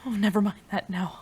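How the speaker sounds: ordinary talk, nothing marked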